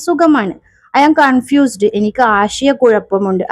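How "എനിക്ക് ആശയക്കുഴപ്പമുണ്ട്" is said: ordinary talk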